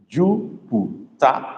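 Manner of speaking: Jupiter drawn out slowly; slow model word. Shown as a demonstration. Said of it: "I've not gotten the right word".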